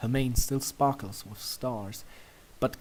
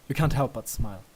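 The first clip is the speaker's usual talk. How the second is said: plain reading voice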